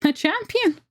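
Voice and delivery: high elf voice, mild amusement; amused elf. Acted. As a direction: scoff lightly at the word.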